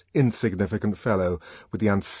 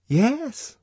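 gossiping woman; 3 dB louder than usual. Acted. Fanny voiced.

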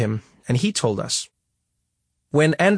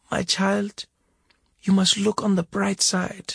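gentle mother; 7 semitones higher than usual. A narrator's reading. Mother in hospital gently comforting her crying son. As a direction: coax him gently.

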